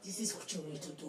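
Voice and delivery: low, hushed, quick imitation; confiding uncle. Uncle showing his borax method, low and confiding. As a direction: drop low and soft as if sharing a method.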